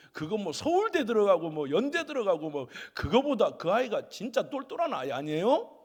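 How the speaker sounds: usual speaking voice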